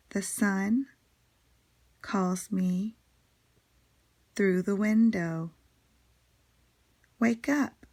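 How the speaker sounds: spaced-out poem reading, short phrases with long pauses; measured recital, lifting on the closing call; poem recitation. Acted. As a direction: let each little line stand alone for young listeners. Reads a poem aloud.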